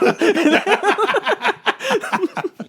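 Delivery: high squealing laugh. Real, not acted. Laughing hard while telling his friend the prank. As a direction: break into a high giggle.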